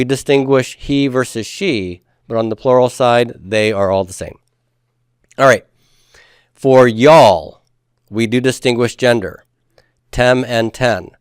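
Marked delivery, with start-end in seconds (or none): none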